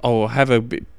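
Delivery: ordinary delivery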